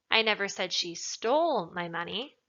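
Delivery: emphatic, stretched "stole"; stress on STOLE. Shown as a demonstration. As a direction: lean hard on the word "stole". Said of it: "maybe she took it".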